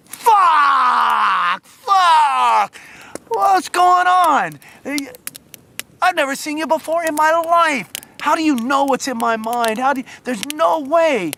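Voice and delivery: high, full-voiced yells of disbelief; astonished shouting. Stranger freaks out at a mind reader, astonished shouting. Retold astonished reaction to guess.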